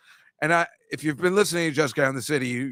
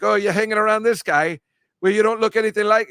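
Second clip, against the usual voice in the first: louder, higher teasing imitation of Tommy's accent; imitating Tommy's accent; 7 semitones higher, 5 dB louder than usual. Host mimics Tommy teasing his sister, loud accented imitation. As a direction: play the bartender ribbing the sister in his accent. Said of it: "There's nothing better than that accent".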